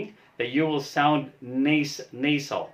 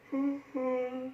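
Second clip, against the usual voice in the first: soft hidden yawn breath; a quiet, near-silent yawn; secret yawn; 11 semitones higher, 9 dB quieter than usual. Shown as a demonstration. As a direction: yawn inside with barely any sound. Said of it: "like that".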